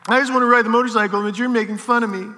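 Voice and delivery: high, fast, whiny imitation of a kid near tears; imitating a crying kid. Kid complains to the teacher who mocked him, whiny near-tears. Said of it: "his goggles started to get a little foggy".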